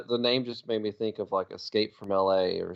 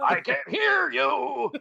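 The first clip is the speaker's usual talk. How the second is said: high, slow, drawn-out pirate holler; pirate shout; 4 dB louder, much slower than usual. Acted. Mock pirate captain demanding a louder answer, high stretched shout. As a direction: bellow it like a cartoon pirate captain. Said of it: "talking like a pirate".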